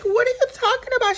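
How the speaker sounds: high, fast whiny question; mock innocence. Dennis denying to Porsha, in a high quick mock-whiny voice. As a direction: play it wide-eyed and squeaky. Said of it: "And Dennis like".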